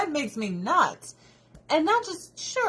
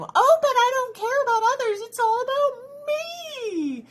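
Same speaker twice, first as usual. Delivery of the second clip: selfish parent mockery; 5 dB louder than usual. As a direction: sneer at a parent who only cares about herself. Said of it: "There's no fucking kids menu".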